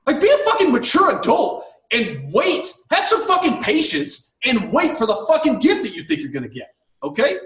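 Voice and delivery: exasperated rant, pitch jumping high; worked-up telling-off with incredulous peaks; fired-up scolding. Real, not acted. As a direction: scold in high, indignant bursts. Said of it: "I get fired up".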